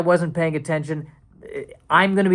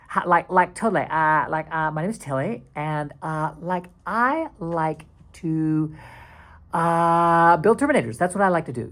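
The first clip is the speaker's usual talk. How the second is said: put-on Valley girl accent; 3 semitones higher than usual. Digital actor Tilly introducing herself, in a Valley accent. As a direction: put on a California Valley accent with stretched words. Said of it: "there's nothing wrong with the Valley accent".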